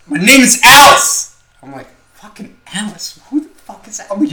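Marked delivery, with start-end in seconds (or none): 0.1-1.4 high, loud and slow, dragging out "Alice"; insistent yell. Retold or imitated. The kid insisting on his name to his rescuer, slow high yell. 2.2-4.1 high, softer disbelieving question; incredulous reply. Retold or imitated. The driver answering the kid's fake name, high incredulous question.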